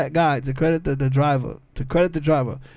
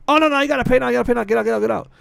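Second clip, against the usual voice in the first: retold insistent cab driver, higher pitched; imitating an agitated driver; insistent cabbie; 7 semitones higher, 3 dB louder, much faster than usual. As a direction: rattle off a cabbie's demands, pitched up. Cab driver arguing in retold row.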